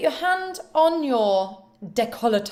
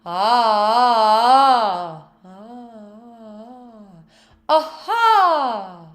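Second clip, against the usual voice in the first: projected ah; 5 dB louder, much slower than usual. Shown as a demonstration. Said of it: "as far as your laptop screen".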